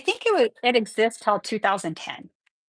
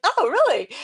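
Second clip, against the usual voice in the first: higher, louder "oh really" in playful disbelief; mock surprise; 8 semitones higher, 5 dB louder than usual. Retelling her reply to people in France, higher and louder in surprise. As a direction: act amazed at the news. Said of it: "I'm like".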